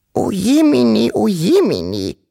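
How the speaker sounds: put-on very high, squeaky old grandmother; piepsende uralte Großmutter. A narrator's reading. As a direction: squeak it out as a fretting granny. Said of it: "piepste die uralte Wawuschel-Großmutter". (hohe, piepsige Großmutterstimme)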